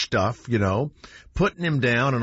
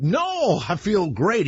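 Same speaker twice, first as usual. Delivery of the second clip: high-pitched, protesting reassurance; overeager denial; 7 semitones higher, much slower than usual. Retold or imitated. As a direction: act out insisting a bit too brightly to a cop. Acted denial to suspicious cop.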